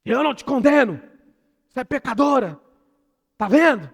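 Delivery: high, loud accusing shout; mock harsh condemnation. Preacher imagines Jesus condemning the sinner, harsh shout. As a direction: hurl it as a scolding accusation, exaggerated. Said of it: "Vocês imaginam que tom que Jesus falou isso?".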